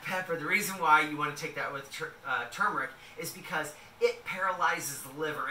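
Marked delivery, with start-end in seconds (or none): none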